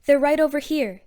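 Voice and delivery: loud, lifted, eager answer; excited. A narrator's reading. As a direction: point them out with excitement. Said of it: "he answered excitedly".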